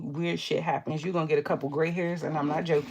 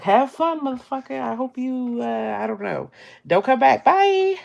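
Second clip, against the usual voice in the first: higher and louder, dismissive; mock shout of good riddance; mock telling off; 7 semitones higher, 7 dB louder than usual. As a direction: wave them off loudly. Telling off people in comments.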